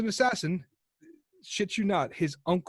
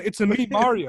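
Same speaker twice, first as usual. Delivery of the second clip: Mario impression; 2 semitones higher, 6 dB louder than usual. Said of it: "the Italian game going".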